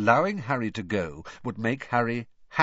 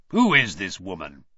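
distaste; 3 semitones lower, 6 dB louder than usual. A narrator's reading. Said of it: "staring at the signature with distaste".

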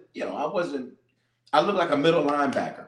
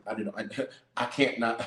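ordinary delivery

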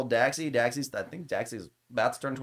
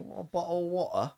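higher, softer English accent impression; mock English accent; 5 semitones higher, 5 dB quieter than usual. Host imitating a British friend, mock English accent. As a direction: do a playful put-on English accent. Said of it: "every time we're around him, I'm like".

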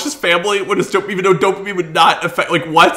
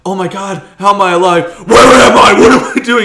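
louder, rising panicked questions; mock bewildered RoboCop; 3 semitones higher, 9 dB louder than usual. Imagining how RoboCop should react on waking. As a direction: play it like a dazed man waking. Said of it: "instead of being like".

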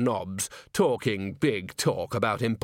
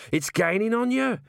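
urgent gnome shout; 11 semitones higher than usual. A narrator's reading. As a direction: hurry him along, yelling from above. Gnome warns of golem.

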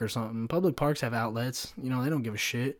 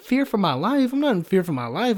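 high-pitched retold disbelief; incredulous retort; 6 semitones higher, 4 dB louder than usual. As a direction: throw it back, higher, as an absurd question.

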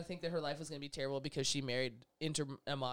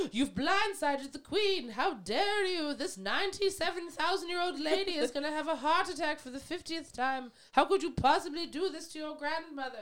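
hammed-up scandalised gasping tirade, much higher pitch; mock outrage; 9 semitones higher than usual. The scandalised public scolding Harry over the queen, mock outrage. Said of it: "And everyone went".

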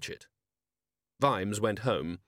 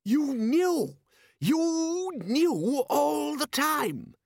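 shouting; much slower than usual. A narrator's reading. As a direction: shout it out in outrage. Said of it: "Rust shouted".

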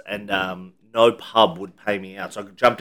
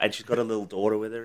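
normal talking voice

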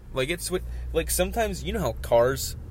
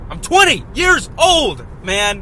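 much higher, much louder exasperated yell; retold shout; 8 semitones higher, 9 dB louder, slower than usual. Retelling his shout at a disgusted driver, exasperated yell. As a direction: shout back at the disgusted lady. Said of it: "I'm like".

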